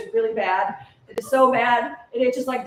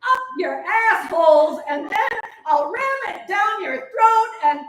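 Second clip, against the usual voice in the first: furious rant; 7 semitones higher, 4 dB louder, slower than usual. Acted. Rage peaks at captors.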